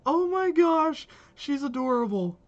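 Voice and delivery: high, loud squeal of delight; excited gushing. Real, not acted. Streamer reacting to an adorable new game character, gushing. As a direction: gush loudly, stretching the opening words.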